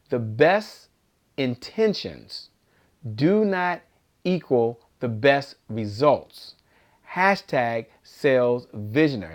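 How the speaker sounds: very slow, with a pause after each phrase; slow, low-pitched and deliberate; slow dictation. Shown as a demonstration. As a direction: let each chunk of the motto sink in. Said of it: "say it again a little slower".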